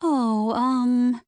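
flustered fillers; embarrassed hesitation. A narrator's reading. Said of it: "cheeks grow warm".

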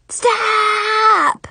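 long, high shouted STOP; screeched interruption. A narrator's reading. Mackenzie bursting in on a near kiss, piercing screech. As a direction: screech it to halt everything.

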